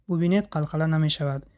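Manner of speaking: usual speaking voice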